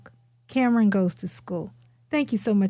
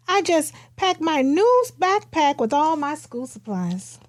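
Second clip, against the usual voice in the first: gentle read-aloud pace, a touch brighter than her own talk; storybook reading; 5 semitones higher than usual. Acted. Author reading her picture book aloud to listeners, storybook read-aloud. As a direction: read it like you're sharing a picture book with kids.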